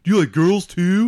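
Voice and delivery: teasing high mock voice. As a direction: tease like a bully. Mocking nerd stereotypes.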